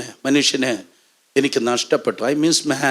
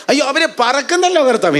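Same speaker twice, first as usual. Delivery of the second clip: high, quick cry of amazement; hammed-up high-pitched wonder; acted astonishment; 13 semitones higher, faster than usual. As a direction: exclaim at an amazing sight.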